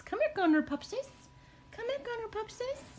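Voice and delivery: very high cooing call. Real, not acted. Baby talk calling the dog.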